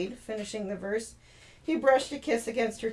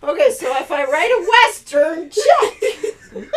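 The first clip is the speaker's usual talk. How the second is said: much higher and louder, through laughter; laughing; 7 semitones higher, 13 dB louder, slower than usual. Real, not acted. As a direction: crack up while speaking. Laughing at own cowboy voice.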